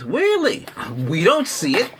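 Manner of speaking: high, surprised disbelief; as if hearing it for the first time; incredulous. Retold or imitated. To the phone agent, retold disbelief. Retelling call about missing delivery.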